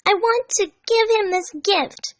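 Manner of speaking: put-on high, slow girlish eagerness; eager Amy. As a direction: gush about the gift. Amy eager about gift.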